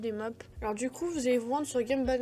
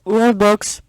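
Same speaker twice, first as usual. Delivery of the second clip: Worldbox shouted out loud; a sudden loud burst on "Worldbox"; punchy yell; 18 dB louder than usual. Real, not acted. Joke: Worldbox, not Roblox.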